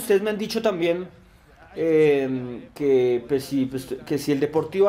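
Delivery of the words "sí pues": normal talking voice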